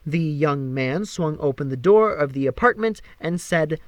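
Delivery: ordinary delivery, nothing marked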